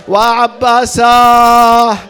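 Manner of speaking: a long, grieving call of the names; wailing lament. Acted. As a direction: wail the names in grief. Mourning cries for Abbas.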